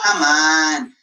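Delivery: ordinary delivery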